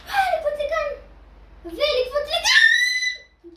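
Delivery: high, loud, stretched-out yell; shouting. Real, not acted. Yelling jump instructions at his teammate, loud. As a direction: bellow the order, dragging it slow.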